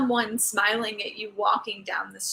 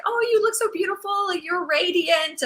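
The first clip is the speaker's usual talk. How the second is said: high, gushing compliment; gushing neighbor; 8 semitones higher than usual. Retold or imitated. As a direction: play the admiring neighbor gushing. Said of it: "She was just like".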